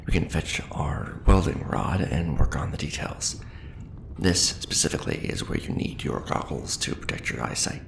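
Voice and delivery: ordinary talk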